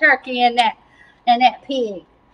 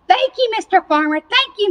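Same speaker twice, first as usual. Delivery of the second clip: quick burst of higher-pitched thanks; grateful pig; 8 semitones higher than usual. Acted. Spared pig thanking the farmer, lightly acted relief. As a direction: gush with relief, pitch jumping up.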